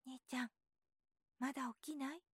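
softly voiced little boy, trying not to wake anyone; hushed so mother keeps sleeping; small voice. A narrator's reading. Boy quietly calls his sleeping older brother, softly. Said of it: "小さな声で言ったのだけれど".

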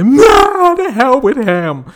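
high, loud cry of protest; belting outburst. Retold or imitated. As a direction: belt it out like a man about to break. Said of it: "belt out and say".

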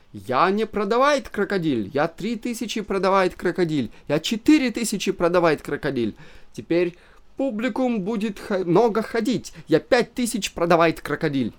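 put-on high, swaggering broken Russian in a German accent. A narrator's reading. German owner brags, raising the crocodile's price.

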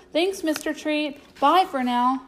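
high-pitched parting call; calling back. A narrator's reading. Boy runs from the clown's tent, calling back thanks. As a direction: call goodbye over your shoulder on the run. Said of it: "calling back over his shoulder".

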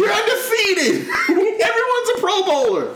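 very high, laughing protest; mock exasperated rant. Co-host heckles the Eagles in jest, mock exasperated rant. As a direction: squeal it in comic outrage through laughs.

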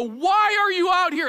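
exasperated parent. Retold or imitated. Fed-up parent confronting child.

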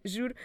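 choking cough. Real, not acted. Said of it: "Juro que me engasguei".

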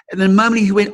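normal talking voice

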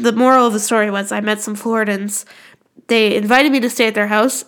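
ordinary delivery

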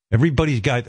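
ordinary talk

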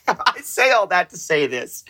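ordinary talk, nothing marked

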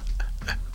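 soft unvoiced chuckle; quiet real laugh, mostly breath; amused chuckle. Real, not acted. Host laughing at a cringy picture, soft chuckle.

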